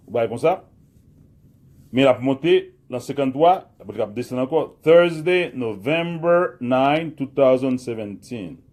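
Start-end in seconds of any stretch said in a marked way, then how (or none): 4.8-8.6 "November" drawn out slowly, louder; teacher dictating the date for students, slow and loud; slow dictation. Shown as a demonstration. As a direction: read the date slowly for the class to copy. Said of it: "Again, nou di lankò, repete li".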